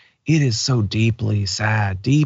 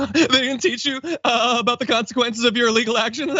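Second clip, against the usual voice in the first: high, mocking parody of a pundit; sneering high-pitched impression of a cable news host; mock Tucker Carlson; 9 semitones higher, faster than usual. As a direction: squeak it out like a smug TV host mocking him. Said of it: "Again, Tucker Carlson".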